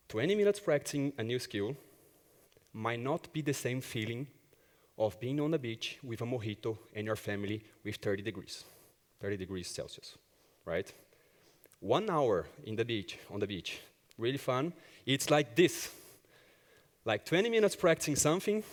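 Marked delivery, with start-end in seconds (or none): none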